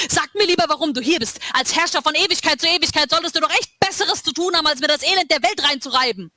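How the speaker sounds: high, heated reproach; angry challenge. A narrator's reading. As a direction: throw it back at him. Lila angrily confronting Samson.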